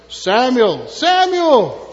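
loud acted summons; calling out. Voice calls sleeping Samuel in story.

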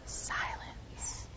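barely audible whisper. Shown as a demonstration. Pastor repeating the key word to children, whispered. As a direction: breathe the word out very softly.

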